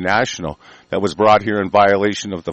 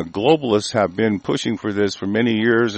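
usual speaking voice

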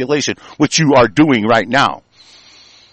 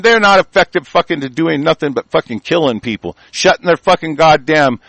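ordinary talk

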